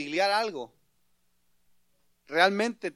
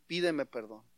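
low and soft, restrained demand; quiet paternal authority; stern, serious father; 5 semitones lower, 5 dB quieter than usual. Acted. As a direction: repeat the order quietly and soberly. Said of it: "lo ve muy serio y le dijo".

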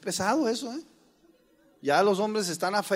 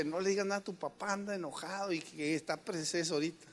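much softer, secretive warning; hushed warning; 9 dB quieter than usual. Retold or imitated. Wife warning the daughters quietly, much softer. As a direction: whisper a warning to the kids. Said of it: "mi esposa dijo".

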